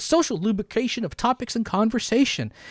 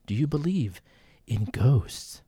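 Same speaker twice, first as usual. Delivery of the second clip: much lower, quieter, eerie; spooky ghost-story voice; 7 semitones lower, 5 dB quieter than usual. Shown as a demonstration. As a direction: draw it out like a haunted host. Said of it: "I've never asked you this".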